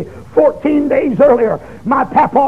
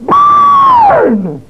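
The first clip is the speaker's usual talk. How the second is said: very high, loud shrieked "Burn"; shrieking yell; 4 dB louder, much slower than usual. Retold or imitated. Young man yelling at the woman in the fire, shrieking. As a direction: scream it through the flames in hate. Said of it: "I yelled through the flames".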